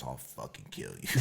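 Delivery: whispered threat through laughter; can't keep a straight face on the threat; a retold threat collapsing into giggles; laughing threat. Retelling Ian's threat, laughing.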